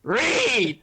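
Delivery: loud annoyed call; high exasperated shout. Real, not acted. Calling friend out, annoyed.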